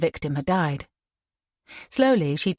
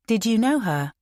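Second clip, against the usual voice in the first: usual speaking voice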